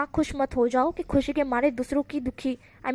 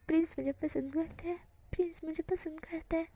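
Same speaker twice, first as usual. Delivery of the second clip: much softer, murmured to himself; softly repeating; 4 semitones higher, 8 dB quieter than usual. Acted. Son murmuring his happy refrain at night, softly. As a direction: repeat it under your breath, dreamily. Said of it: "धीरे-धीरे बोल रहा था".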